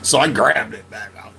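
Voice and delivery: usual speaking voice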